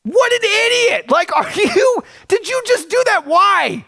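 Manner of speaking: high, incredulous mock outburst; retold incredulity. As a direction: stare at the man in disbelief. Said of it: "and I'm like".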